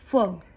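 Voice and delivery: फ as a short f sound, not the letter name; just the sound फ, no vowel; फ, only the consonant; phonic sound. Shown as a demonstration. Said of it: "एफ का sound होता है".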